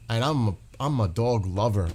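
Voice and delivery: ordinary talk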